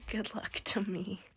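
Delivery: slow hoarse whisper; lost voice. Real, not acted. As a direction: whisper it wryly through a sore throat. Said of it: "I'm sure you can hear my voice".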